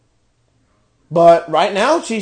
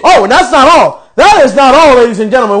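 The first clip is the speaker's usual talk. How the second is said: loud sarcastic build-up; 3 semitones higher, 7 dB louder than usual. Real, not acted. Ranting, announcing more bad news.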